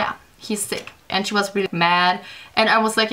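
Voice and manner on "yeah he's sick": sheepish whisper. Retold or imitated. Admitting to the angry teacher.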